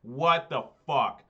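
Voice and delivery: loud, slow disbelieving curse; real exasperation. Real, not acted. Streamer shouting at a bad draw in the league. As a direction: let the frustration out. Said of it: "Deck, I swear to God".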